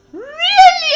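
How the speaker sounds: very loud, high mock-astonished cry; amazed disbelief. American swallowing a tall tale about tribes, imitated amazement. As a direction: shout it like a gullible listener, wide-eyed.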